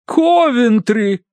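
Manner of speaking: high, drawn-out discovery; revelation. A narrator's reading. Mrs. Mering guesses Coventry, high and drawn-out. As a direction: stretch it out in triumph.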